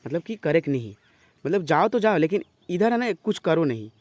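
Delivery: ordinary delivery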